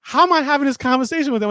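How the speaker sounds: high, fast disbelief; retold incredulous question; bewildered. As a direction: blurt it out in baffled high pitch. Retold bewilderment at silent Prince.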